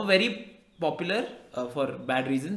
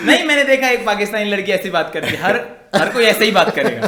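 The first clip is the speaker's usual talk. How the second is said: put-on Pakistani accent; 2 semitones higher, 7 dB louder than usual. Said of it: "How is the Pakistani accent?".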